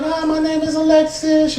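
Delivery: put-on high, sweet little girl voice; a small girl introducing herself, lightly. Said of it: "She's like".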